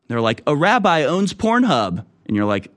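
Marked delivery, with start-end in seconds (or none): 0.5-2.0 high-pitched, upbeat boast; proud announcement. Acted. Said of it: "why'd you say it like that".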